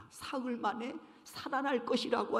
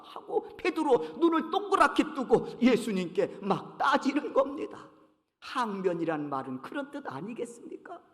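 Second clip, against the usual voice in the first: ordinary delivery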